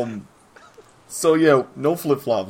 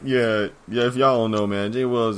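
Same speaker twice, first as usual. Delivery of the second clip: ordinary talk, nothing marked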